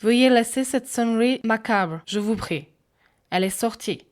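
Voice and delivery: put-on prim request in English-accented French. A narrator's reading. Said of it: "dans un accent anglais".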